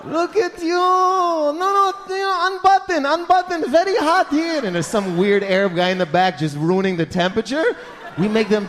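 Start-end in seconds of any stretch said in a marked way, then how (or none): 0.0-4.6 put-on high-pitched, gushing older man; creepy feminine fawning. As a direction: fawn over her in a high voice. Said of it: "they always get feminine for no reason".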